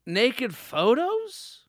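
high, slow, drawn-out soothing put-on voice; calm her down like a slick liar; smooth sly cheater. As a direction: boyfriend slowly smooth-talking his girlfriend out of her anger. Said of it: "J Train Theater".

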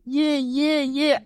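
high-pitched excited cheer; retold cheering. Host retelling their reaction to the speech, high cheering. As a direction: chant it high like a fan.

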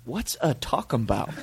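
feigned confusion. Retold or imitated. Jeremy playing dumb with the angry driver, feigned confusion. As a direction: ask as if "talking about" were one word. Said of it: "we act like it's one word".

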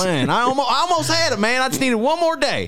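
high, whiny sulking gator; Alligator Bob voice. Acted. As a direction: complain like a fired alligator. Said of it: "My Alligator Bob impression".

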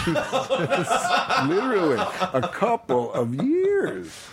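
high, slow, laughed out; amused, laughing at himself. Real, not acted. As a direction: stretch it out while laughing. Laughing at own admission.

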